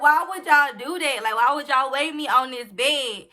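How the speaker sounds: loud, high exasperated demand; incredulous retold complaint, pushed up loud; indignant protest. Retold protest at being weighed wrongly.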